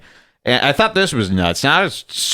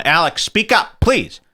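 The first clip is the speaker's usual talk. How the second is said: mock plea, rising higher; a higher pleading voice asking him to speak up; imitating a producer; 7 semitones higher than usual. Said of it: "nobody will tell him".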